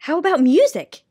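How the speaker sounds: put-on mildly excited young hero. A narrator's reading. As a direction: ask with a lift of excitement. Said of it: "asked Milo excitedly".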